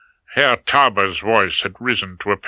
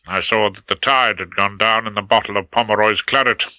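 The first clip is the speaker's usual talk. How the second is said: ordinary delivery, nothing marked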